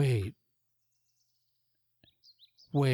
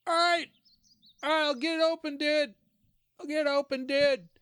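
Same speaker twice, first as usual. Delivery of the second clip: much higher, easygoing dude voice; laid-back guard; 12 semitones higher than usual. Acted. Gatekeeper Mikey calls back that he'll open it. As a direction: chill guard answering the yell. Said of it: "and you go".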